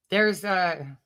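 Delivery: normal talking voice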